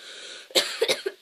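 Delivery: cough. Real, not acted. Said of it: "that voice just makes me cough".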